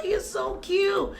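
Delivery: ordinary talk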